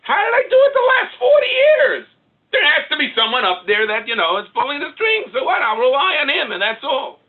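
very high, loud cry to himself; impassioned shouting. Acted. Preacher acting out his self-talk on faith, high impassioned shout. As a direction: cry it out with full-force conviction.

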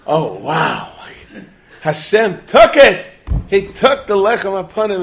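loud, high-pitched overjoyed exclamation; excited amazement. Acted. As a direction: cry out as if witnessing a miracle. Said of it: "like, excited".

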